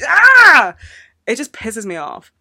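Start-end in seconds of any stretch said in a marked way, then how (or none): 0.1-0.7 loud, high frustrated "ah"; high-pitched burst of frustration; frustrated scream. Real, not acted. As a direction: let out a sudden scream of exasperation. Venting about unfunny OCD jokes.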